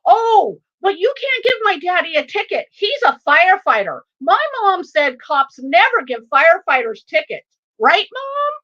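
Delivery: put-on high, loud, eager child voice; outspoken young son. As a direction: argue like a six-year-old with no filter. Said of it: "He's like".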